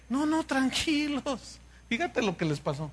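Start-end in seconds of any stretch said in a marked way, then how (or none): 0.1-1.6 soft, slow calming voice; calm reply. Retold or imitated. God calms his demanding people, slow and soft. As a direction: settle them down gently.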